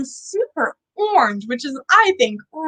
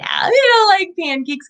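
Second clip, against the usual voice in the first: playful outburst; 7 semitones higher than usual. Real, not acted. Teasing kids about wrong answer.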